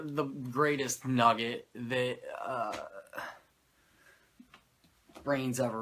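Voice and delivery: normal talking voice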